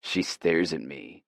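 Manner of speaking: plain reading voice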